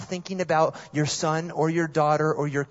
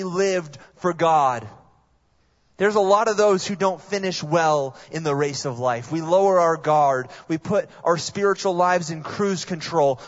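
rising to a louder preacher's warning; preaching; 3 semitones higher, 4 dB louder than usual. Acted. Preacher warning the congregation, louder and urgent. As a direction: press the danger home from the pulpit.

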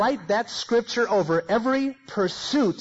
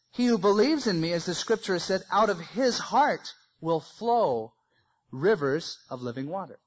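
slow solemn reading. Retold or imitated. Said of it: "he says".

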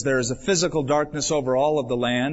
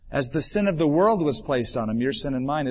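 ordinary talk

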